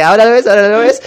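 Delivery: quick singsong trick patter; fast magician patter. Acted. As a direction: rattle it off like a street magician. Jesús como mago. (patter de mago, rápido)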